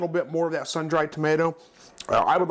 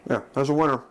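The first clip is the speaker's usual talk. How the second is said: mouth full. Real, not acted. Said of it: "so I'm not talking with my mouth full".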